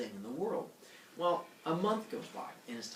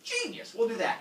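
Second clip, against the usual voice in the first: high-pitched burst of mock delight; hammed-up eager approval; 9 semitones higher than usual. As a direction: leap on the idea with sudden enthusiasm. King delighted by the plan.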